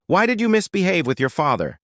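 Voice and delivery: high-pitched, understated scolding. A narrator's reading. Pregnant mother questioning her daughter's behaviour, mildly scolding. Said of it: "scolds her daughter".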